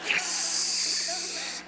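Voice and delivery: a high, delighted little cry of victory; triumphant yes. Real, not acted. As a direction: celebrate getting the name right. Relieved Google got it right.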